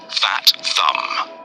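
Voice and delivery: usual speaking voice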